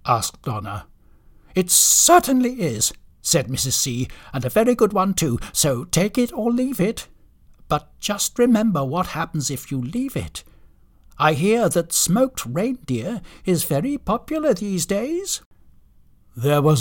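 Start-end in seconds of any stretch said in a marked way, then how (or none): 1.6-2.9 high, loud firm retort; brisk, bossy confirmation; emphatic. Acted. Wife cuts in sternly. 4.3-15.4 high-pitched, brisk bossy ultimatum; bossy wife voice, rising at the end; stern wife. Acted.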